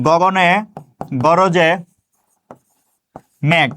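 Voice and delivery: each word set apart by long pauses; slow word-by-word dictation. Shown as a demonstration. Teacher dictating a poem line to students, spaced out word by word. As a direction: dictate it slowly, one word at a time. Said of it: "সোনার তরী কবিতাটা লিখলাম".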